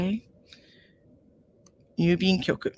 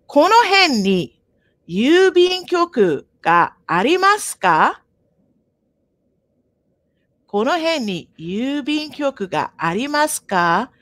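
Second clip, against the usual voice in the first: model question; 5 semitones higher than usual. Shown as a demonstration. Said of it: "let's try this conversation".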